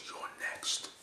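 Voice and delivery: low hushed mock threat; lightly voiced tough-guy warning; menacing threat. Wrestler threatening in retold promo.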